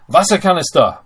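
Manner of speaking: Wasserkanister called out high and loud; announced German word. Shown as a demonstration. Teacher presents the German word for water can, high and loud. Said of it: "a water can is a Wasserkanister".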